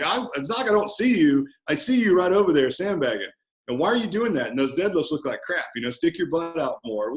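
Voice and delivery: high, loud coach calling out a slacker; animated, ribbing critique; friendly challenge. Acted. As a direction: holler across the gym in mock reproach. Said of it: "challenging someone who's more advanced in a friendly manner".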